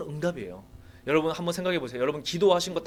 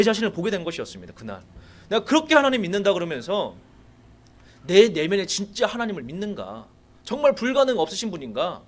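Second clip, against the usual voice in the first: louder, driving preacher's challenge to the congregation; fervent preaching; 3 semitones higher, 4 dB louder than usual. Acted. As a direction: press the congregation with rising fervour. Preaching at full force. (회중에게 도전하듯 힘있게 외치는 설교)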